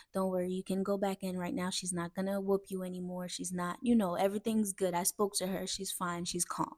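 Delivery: low, very soft comforting tone; reassuring. Retold or imitated. Neighbor telling dad he can go back in, reassuring. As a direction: gently coax someone that all is safe.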